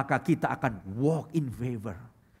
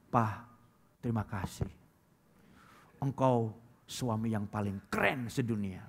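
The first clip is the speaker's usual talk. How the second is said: sweet thanks; 6 semitones lower, 7 dB quieter than usual. Retold or imitated. Preacher models a wife thanking her husband, soft and sweet. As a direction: coo it to flatter your husband. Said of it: "dapat duit bilang".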